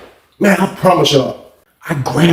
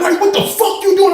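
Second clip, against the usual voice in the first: high-pitched mock outrage; alarmed police challenge; 13 semitones higher than usual. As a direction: imitate a startled cop demanding answers.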